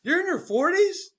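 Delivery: mock the shock of young coworkers; imitating incredulous coworkers. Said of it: "everyone I work with's like".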